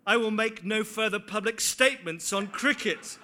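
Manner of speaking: loud, mock-formal announcement; mock official statement. Preacher joking about the cricket umpiring, mock-formal. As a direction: play it like a pompous press release. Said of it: "mock official statement".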